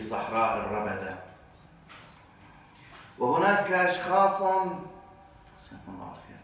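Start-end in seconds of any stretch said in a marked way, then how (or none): 5.6-6.4 breathy whispered thanks, off-mic; whispered aside. Real, not acted. Preacher thanks someone mid-sermon, whispered aside. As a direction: drop to a whisper for a quick thank-you.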